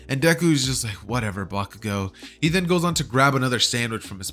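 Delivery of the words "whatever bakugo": softer, bored brush-off; dismissive. Acted. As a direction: shrug him off quietly. Deku brushing off Bakugo.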